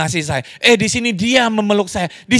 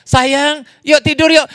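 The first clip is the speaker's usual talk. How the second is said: higher, louder mock call to his wife; husband calling out; 8 semitones higher, 3 dB louder, slower than usual. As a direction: call her to bed, playfully raised. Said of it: "Terus dia teriak".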